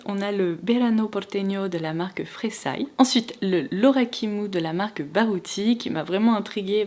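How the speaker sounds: ordinary talk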